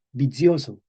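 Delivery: vicioso as bi-TSIO-zo; medieval pronunciation. Shown as a demonstration. Teacher assembling the old word, medieval pronunciation. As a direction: say vicioso with ts, then a voiced z. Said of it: "esto lo decían ellos".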